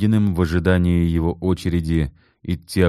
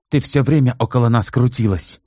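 with hatred; 7 semitones higher than usual. A narrator's reading. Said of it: "с ненавистью сказала Валентина Степановна".

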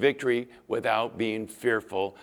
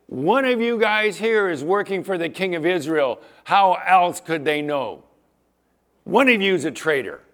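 angry king; 4 semitones higher, 7 dB louder than usual. Retold or imitated. Angry king accuses his court.

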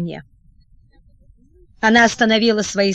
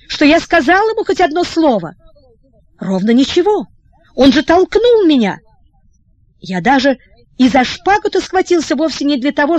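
despair; 4 semitones higher than usual. Acted. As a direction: cry out in helpless despair. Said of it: "заломил в отчаянии руки".

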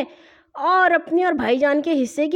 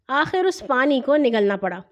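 ordinary delivery, nothing marked

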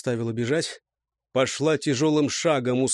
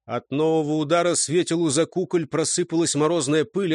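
ordinary delivery, nothing marked

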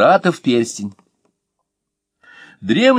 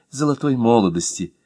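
plain reading voice

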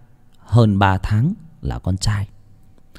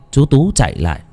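plain reading voice

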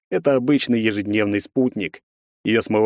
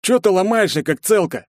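mocking thug; 10 semitones higher, 3 dB louder than usual. Second thug mocks girl.

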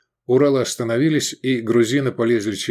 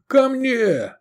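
much higher call; 14 semitones higher than usual. A narrator's reading. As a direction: call him over urgently. Calling comrade in battle.

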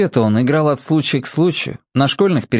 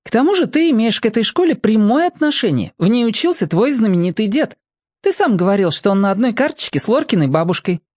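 put-on assertive girl; 11 semitones higher than usual. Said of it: "Лика напористо сказала Ване".

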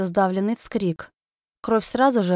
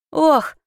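choked gasp; 8 semitones higher, 6 dB louder than usual. Acted. Man grabbed by the neck.

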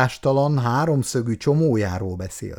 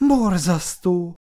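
higher, slow, fretful exclamation; nervous outburst; 8 semitones higher, slower than usual. A narrator's reading. Icu frets aloud to the writer, slow and high. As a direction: let the nerves burst out in one word. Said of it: "kiszakadt egy ideges sóhajtás".

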